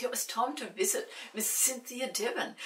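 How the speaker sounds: plain reading voice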